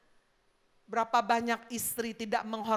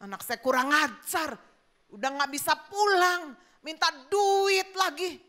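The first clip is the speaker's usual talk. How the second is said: indignant dad's raised complaint; hammed-up indignant outburst; angry father; 3 semitones higher, 3 dB louder than usual. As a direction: fume about an ungrateful child, acted. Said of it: "saya marah ini".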